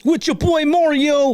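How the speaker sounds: hype intro shout. Acted.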